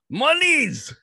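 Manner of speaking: high, loud mock Russian cry; Russian accent. Greedy handler keeping Fedor fighting, Russian accent. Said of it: "That's my best Russian accent".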